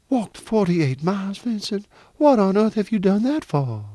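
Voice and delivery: high, incredulous mother; astonished. Acted. Mother hears of her son's long walk, astonished.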